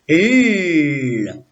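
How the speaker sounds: L sounded alone, long and held; son isolé. Shown as a demonstration. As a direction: hold the bare sound of L, no letter name. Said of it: "on entend le petit son". (L prononcé seul, tenu longuement)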